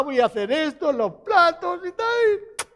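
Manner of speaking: high, stretched mock whine; hammed-up tearful bargaining, pitch climbing; pleading child. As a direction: play a child desperately promising chores. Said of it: "cuando un hijo viene".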